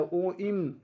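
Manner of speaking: ओइम with the O drawn out, then closed on m; prolonged pluta vowel. Shown as a demonstration. Teacher repeating the reading for students, ओइम drawn out. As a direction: hold the O in ओइम before the m. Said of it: "पढ़ा ऐसे जाता है".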